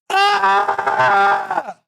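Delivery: high, loud yelp of pain; mock pain yell. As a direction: yelp like you just got nicked. Scissors cut joke, yelling.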